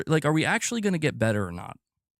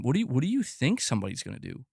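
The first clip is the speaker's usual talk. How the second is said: ordinary delivery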